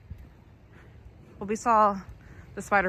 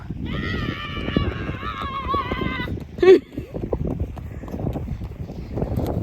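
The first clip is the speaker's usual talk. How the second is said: shrieking laugh. Real, not acted. Child runs off, mom laughs.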